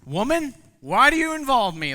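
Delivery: high, loud scolding retort; harsh rebuke reading. Shown as a demonstration. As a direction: snap it like a rude son to his mother. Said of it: "we read this like this".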